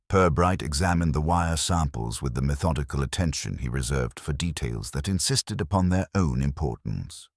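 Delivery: usual speaking voice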